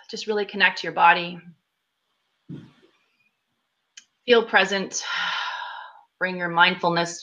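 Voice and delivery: unhurried guiding voice with long pauses between phrases; guided meditation. Acted. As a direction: lead a group through breathing and visualization, leaving room after each phrase. Leads guided meditation after plain intro.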